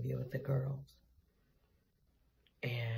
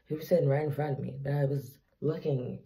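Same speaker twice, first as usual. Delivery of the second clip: usual speaking voice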